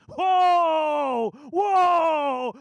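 high, loud astonished cry; loud whoa. Retold or imitated. Passenger suddenly touched by prayer, loud astonished whoa. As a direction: shout it out, amazed. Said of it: "He's really loud".

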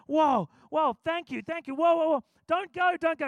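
high, loud, breathless gratitude; begging him to stay, overjoyed; overwhelmed thanks. Retold or imitated. Touched passenger begging preacher not to leave, high excited.

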